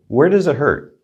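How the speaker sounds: ordinary delivery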